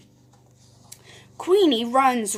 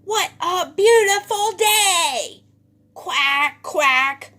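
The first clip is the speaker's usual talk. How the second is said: loud, high noisy-duck voice; boisterous duck shout ending in quacks; 6 semitones higher, 6 dB louder than usual. A narrator's reading. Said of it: "shouts Dottie".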